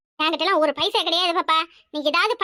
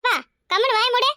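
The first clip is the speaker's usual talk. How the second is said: snapped, higher and louder order to shut up; sharp hush; 5 semitones higher, 4 dB louder than usual. Acted. Mother snapping at daughter in front of aunt, sharp and louder. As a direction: cut her off hard, a touch tearful.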